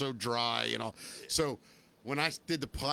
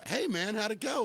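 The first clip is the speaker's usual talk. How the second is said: higher-pitched retold hello; upbeat greeting; 7 semitones higher than usual. Friend asking how the audition went, upbeat. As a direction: lift the pitch, eager to hear.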